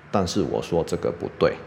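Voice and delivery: ordinary delivery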